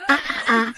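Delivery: short, very high panicked cries; high frightened screams. Acted. Trapped woman shrieking at her boss, high frightened screams. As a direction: shriek as he grabs you.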